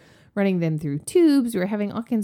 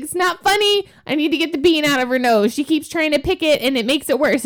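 higher, louder mock indignant; upset mom; 7 semitones higher, 5 dB louder than usual. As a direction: insist to the laughing nurse. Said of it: "And I was like".

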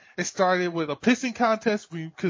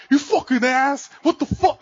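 high, raised mock yell at a friend; imitating a raging gamer; mock angry outburst; 7 semitones higher than usual. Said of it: "someone's just like".

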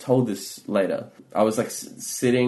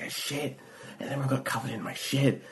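soft, high, strained near-whisper; acted hushed confession; 5 semitones higher, 9 dB quieter than usual. Explaining the disaster to a friend, hushed confession. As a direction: confide it urgently, keeping it down.

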